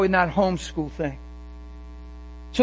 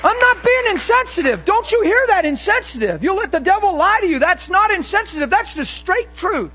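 higher, louder fiery preaching; full-force preaching; 8 semitones higher, 3 dB louder than usual. Acted. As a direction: drive it home to the congregation.

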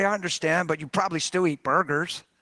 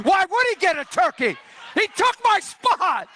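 high, hammed-up outrage; mock indignant; 10 semitones higher, 3 dB louder than usual. Preacher complaining about friend taking his spot, mock indignant. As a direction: protest high, dragging out the grievance. Comic grievance against friend.

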